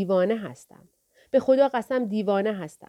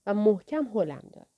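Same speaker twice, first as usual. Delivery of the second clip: usual speaking voice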